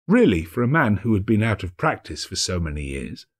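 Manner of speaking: ordinary delivery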